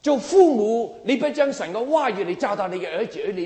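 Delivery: high, loud rebuke to parents. Real, not acted. Preacher scolds parents who fail to teach, loud rebuke. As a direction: call the parents to account, loud.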